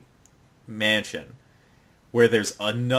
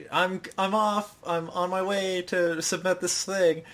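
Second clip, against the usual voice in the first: mock anime girl; 5 semitones higher than usual. Retelling anime character's line.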